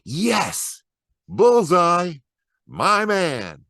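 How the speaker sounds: high, loud whooping cheers; cheering fan. Acted. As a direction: cheer like it's the winning shot. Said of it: "fans who cheer from the empty bleachers".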